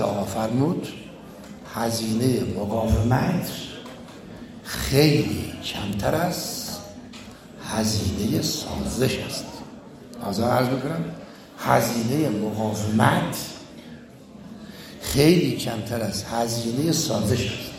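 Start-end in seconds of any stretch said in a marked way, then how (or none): 1.7-9.4 low, very slow and deliberate; measured, low-pitched pronouncement; slow, weighty quote. Retold or imitated. Quotes leader's maxim as key sentence. 11.6-17.7 low, very slow and deliberate; measured, low-pitched pronouncement; slow, weighty quote. Retold or imitated. Repeats the maxim for emphasis.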